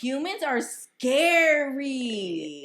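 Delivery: high, loud, long-drawn exclamation; mock horror. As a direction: wail it slowly in exaggerated dismay. Mock dismay at people's behavior.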